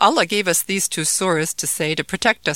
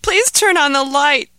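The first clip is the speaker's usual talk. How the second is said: higher, louder pleading please; desperate begging; 9 semitones higher, 8 dB louder than usual. Acted. Terrified boy pleads again for the light, desperately. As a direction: beg harder, desperate and scared. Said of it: "begged Ahmed".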